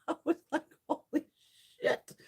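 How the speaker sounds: high, laughter-broken words; laughing. Real, not acted. Amazed at sixteen cartons brought home, laughing. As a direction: squeeze the words out through real laughter.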